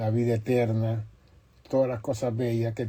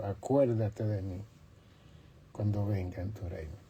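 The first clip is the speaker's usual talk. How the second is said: much softer, lower, hushed plea; 4 semitones lower, 7 dB quieter than usual. Acted. Repentant thief asks Jesus to remember him, hushed and soft. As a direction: murmur the request humbly.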